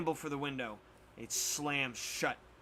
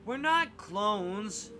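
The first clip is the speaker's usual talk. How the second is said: slow exclaim, stressing not; 7 semitones higher, 5 dB louder, much slower than usual. Acted. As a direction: say not firmly and slowly. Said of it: "Sam exclaims".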